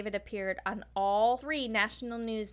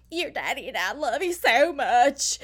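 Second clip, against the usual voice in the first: hammed-up Southern crying plea, louder; mock Southern sob; 4 semitones higher, 8 dB louder than usual. Mocking a mother's televised plea, fake Southern sobbing. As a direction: overdo a tearful Southern mother on TV. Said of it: "living for your accent right now".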